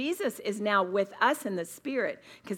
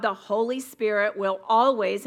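always leaned on louder; stress on always; 5 dB louder than usual. Shown as a demonstration. Preacher stressing always to the women. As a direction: press the word always hard. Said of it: "I am emphasizing the word always".

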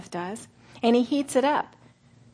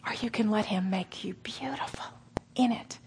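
whisper; 8 dB quieter than usual. Real, not acted. Tender appeal to the women.

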